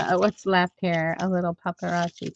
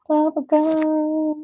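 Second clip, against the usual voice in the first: high drawn-out; 6 semitones higher, slower than usual. Shown as a demonstration.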